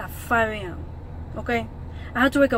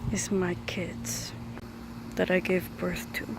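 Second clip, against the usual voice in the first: slow whispered reveal; hushed mock introduction; 4 semitones lower, 7 dB quieter than usual. Introduces birds as his kids.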